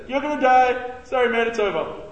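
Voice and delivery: high, loud, offhand bluntness; blunt paraphrase. Retold or imitated. Youth leader mimics Isaiah's blunt news, loud and casual. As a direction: toss it off like no big deal. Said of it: "That's what he says".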